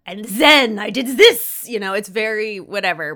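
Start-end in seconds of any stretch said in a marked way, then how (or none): 0.1-1.6 slow, loud, hammed-up French accent; mock French accent. Host mocking the show's bad French accents, hammed-up French accent. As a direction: drawl it out in a cartoon French accent. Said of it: "It's very like".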